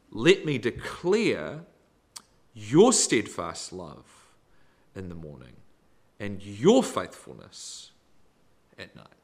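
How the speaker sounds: YOUR hit loud and drawn out, slow; stress on YOUR. Real, not acted. Preacher points congregation to whose love it is, YOUR stressed. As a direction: lean hard on each "your", then drop away. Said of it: "Notice I emphasize the word "your".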